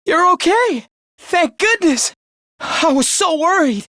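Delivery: high-pitched rush of relief; relieved. Acted. Finding a missing friend safe after worrying, relieved. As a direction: let the worry spill out, voice lifted.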